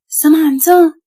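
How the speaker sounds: high, loud call of the name; mother calling her child home. A narrator's reading. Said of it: "It was Mama's voice".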